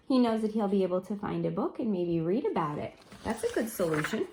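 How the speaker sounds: ordinary delivery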